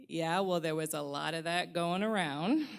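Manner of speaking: a private jab she never says aloud; snarky inner voice. Pretend. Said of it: "the little voice in my head".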